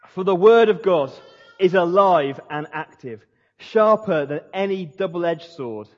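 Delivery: loud, slow proclaiming of scripture; scripture reading, loud. Shown as a demonstration. Preacher reading Hebrews aloud to his congregation, proclaiming loud. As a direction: proclaim it like a preacher at full force. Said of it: "it says this".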